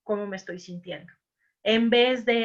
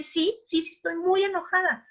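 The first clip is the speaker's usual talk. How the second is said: higher-pitched agreeing voice, lightly acted; la otra persona, enojada; 8 semitones higher than usual. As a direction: play the upset person admitting it, pitched up. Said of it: "la otra persona puede decir". (persona enojada que asiente, voz más aguda)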